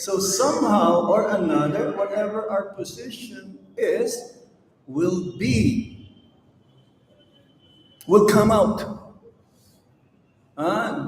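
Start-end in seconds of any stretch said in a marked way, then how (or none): none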